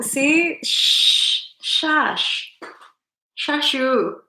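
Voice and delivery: mock scolding shush; playful shushing. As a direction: hush them in a high playful voice.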